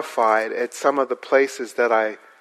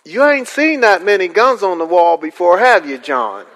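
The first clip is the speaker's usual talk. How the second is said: proud rural host; 6 semitones higher, 6 dB louder than usual. Retold or imitated. Host shows off guns.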